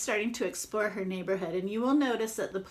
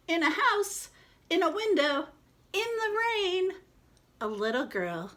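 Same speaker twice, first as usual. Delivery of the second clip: building storybook read. Acted. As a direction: read it like a zoom from the universe down to one window. Reads the opening passage aloud.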